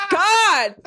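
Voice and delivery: high, exaggerated Southern drawl; hammed-up impression of a Southern accent; mocking Southern accent. Mimicking the co-host to the co-host's face, mocking Southern accent. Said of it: "That's what you're talking like".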